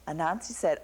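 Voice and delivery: plain reading voice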